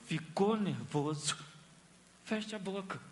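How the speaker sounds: calm the listener down gently; quiet, slow reassurance; soothing advice. Acted.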